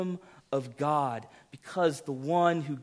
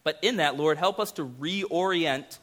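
earnest prayer, steady and slightly louder; pastoral prayer; 4 semitones higher, 4 dB louder than usual. Acted. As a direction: pray humbly for the congregation. Leads a prayer.

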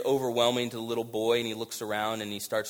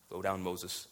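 softer, lower repeat of the command; hushed, solemn repeat of the command; quiet echo; 7 semitones lower, 12 dB quieter than usual. Acted. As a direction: let the echo fall away softly. God's command repeated, much quieter.

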